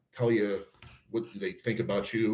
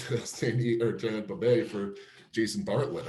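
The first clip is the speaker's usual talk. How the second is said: normal talking voice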